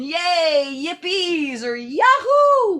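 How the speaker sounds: high, loud cheering whoop; celebration yell. Shown as a demonstration. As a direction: cheer like you just won. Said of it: "An extra celebration".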